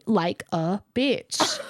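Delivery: slow, each syllable apart; like a bitch said syllable by syllable; spelled out in syllables. Retold or imitated. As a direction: drag it out syllable by syllable. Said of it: "spelled it out in syllables for me".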